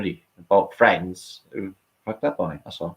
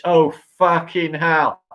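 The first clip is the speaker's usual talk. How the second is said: ordinary talk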